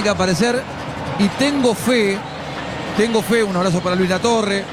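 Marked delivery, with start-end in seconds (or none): none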